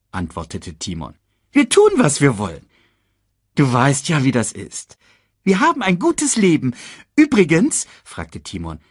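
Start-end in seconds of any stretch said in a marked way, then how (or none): 1.5-7.9 high, quick cartoon meerkat; chirpy little sidekick voice. Timon boasts.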